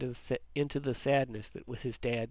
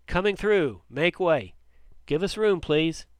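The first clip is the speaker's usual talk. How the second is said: higher and louder, urgent calls; excited shouting; 4 semitones higher, 6 dB louder than usual. A narrator's reading. Paramedic clears a path through the crowd, excited shouting. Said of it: "an excited voice saying".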